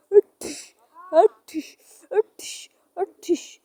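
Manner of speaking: high, sharp imitated blows; fight noises. Ghost attacks the girl, imitated fight noises. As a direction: spit out mock combat impacts.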